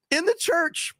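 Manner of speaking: high-pitched incredulous exclamation; aghast reveal; scandalized punchline. Real, not acted. Scandalous punchline of his story.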